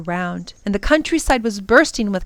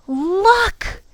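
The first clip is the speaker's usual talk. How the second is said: put-on high-pitched child's exclamation; excited cry; 12 semitones higher than usual. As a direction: call out high with sudden delight. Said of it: "she cried excitedly and pointed".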